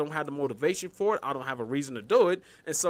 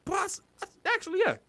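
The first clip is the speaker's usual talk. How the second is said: high mock reply. His imagined reply.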